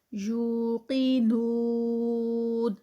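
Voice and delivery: یوقنون with no nasal sound on the واو; recited without nasality. Shown as a demonstration. Teacher warns about nasal mistake, clean recitation. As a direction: recite یوقنون slowly, keep the sound out of the nose. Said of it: "ناک میں آواز نہ جائے".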